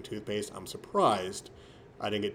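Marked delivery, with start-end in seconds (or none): none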